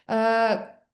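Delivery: usual speaking voice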